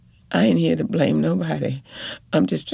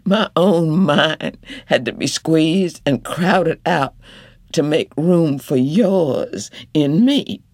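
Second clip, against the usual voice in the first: indignant wife; 3 semitones higher than usual. Acted. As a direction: refuse to hush, lay it all out. Wife voiced, rising anger.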